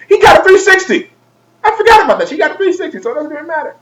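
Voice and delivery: high-pitched mock excitement; squeaky sudden realization. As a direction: leap up into a comic squeal and stay there. Sudden mock realization about brother's console.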